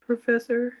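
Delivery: ordinary delivery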